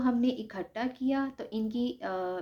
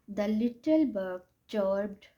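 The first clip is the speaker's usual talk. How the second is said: slow dictation; much slower than usual. Shown as a demonstration. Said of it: "little bug ने क्या किया".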